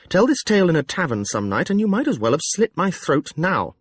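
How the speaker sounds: pleading. Acted. The fool begging Jekk to keep his secret, pleading. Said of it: "And then pleadingly".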